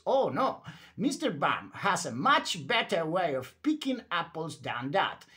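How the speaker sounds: plain reading voice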